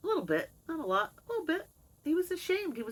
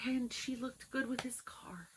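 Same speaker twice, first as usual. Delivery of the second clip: slow whispered aside; slow conspiratorial whisper; 6 semitones higher, 8 dB quieter than usual. Real, not acted. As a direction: whisper it knowingly.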